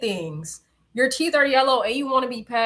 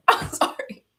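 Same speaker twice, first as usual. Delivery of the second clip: high laughing sorry; laughing apology; 7 semitones higher, 8 dB louder than usual. Real, not acted. Apologizing to viewers after coughing, laughing. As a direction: apologize while cracking up.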